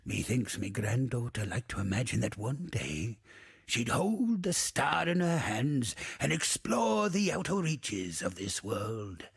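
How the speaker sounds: usual speaking voice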